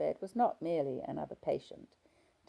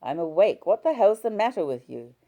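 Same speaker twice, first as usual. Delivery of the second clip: angry husband; 5 semitones higher, 12 dB louder than usual. Acted.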